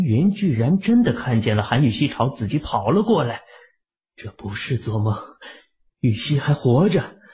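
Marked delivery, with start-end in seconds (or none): none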